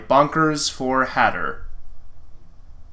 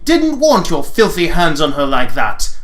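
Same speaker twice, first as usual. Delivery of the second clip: slightly louder, sneering; a hint of a haughty prince; 5 dB louder than usual. Prince scorning the paladin, lightly voiced sneer. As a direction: sneer it down at him.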